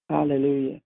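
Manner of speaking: normal talking voice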